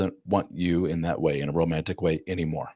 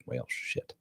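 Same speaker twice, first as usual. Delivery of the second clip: muttered curse; 6 semitones lower, 14 dB quieter than usual. Retold or imitated. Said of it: "she's like".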